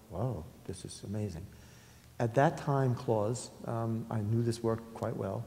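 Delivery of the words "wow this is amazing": 1 hushed awe. Retold or imitated. Recalling awe at the artwork.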